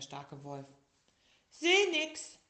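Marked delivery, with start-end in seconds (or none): 1.5-2.4 put-on high, loud small-child voice; little tiger asking. A narrator's reading. Sick little tiger asking the wolf, high small voice. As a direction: ask like a tired little kid. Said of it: "sagte der kleine Tiger".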